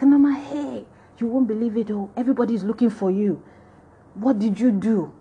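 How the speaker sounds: put-on high, excited Nigerian-accented alarm; Nigerian intonation. Schoolgirl warning Norma everyone's looking for her, Nigerian accent. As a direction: gasp out the news in a Nigerian lilt. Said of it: "there's gonna be a lot of Nigerian intonations".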